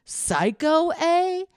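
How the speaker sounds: high, loud threatening question; menacing retort. Retold or imitated. Woman retelling her taunt before kicking him, loud menacing question. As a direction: throw the word back at him.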